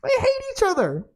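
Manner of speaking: high, incredulous squeak of disbelief. Retold or imitated. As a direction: squeal in mock outrage at the absurdity. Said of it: "and I was like".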